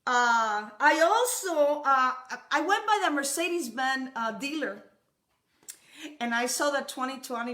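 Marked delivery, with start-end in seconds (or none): none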